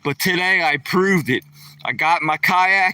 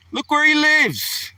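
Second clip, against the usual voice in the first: excited; 12 semitones higher than usual. Real, not acted. Showing off the dog's place.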